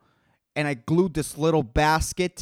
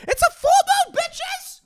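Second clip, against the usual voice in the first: falsetto party squeal; high squeal; 3 dB louder than usual. Acted. Squealing celebration.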